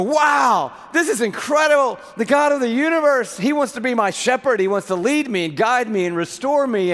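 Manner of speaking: a believer blown away at God's table; excited guest. Acted. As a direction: shout it like an overwhelmed fan. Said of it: "So we would go".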